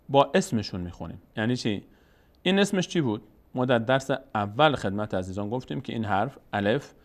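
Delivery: ordinary talk, nothing marked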